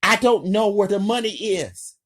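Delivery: high, loud, fast pleading; terrified captive pleading with the gunman; panicked denial. A narrator's reading. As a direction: blurt it out in panic.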